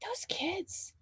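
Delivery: hushed aside; breathy stage whisper. Real, not acted. Aside about the kids.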